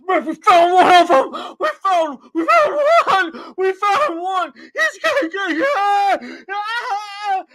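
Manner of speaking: very high, loud frenzied screaming; freaking out. Retold or imitated. Crowd yelling into cell phones about him, freaking out. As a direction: imitate a mob whipping itself into a frenzy. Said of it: "freaking, freaking out".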